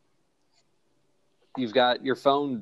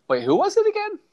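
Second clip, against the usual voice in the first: villager voice; 5 dB louder, faster than usual. Pretend. Villager reacting to town crier.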